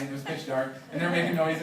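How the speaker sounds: ordinary talk, nothing marked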